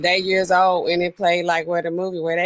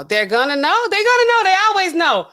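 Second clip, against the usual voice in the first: high, louder, knowing sing-song; mock warning; 11 semitones higher, 4 dB louder than usual. Streamer warning the chat that people always find out, high and loud. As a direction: tease that everyone finds out.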